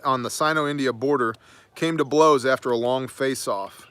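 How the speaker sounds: ordinary talk, nothing marked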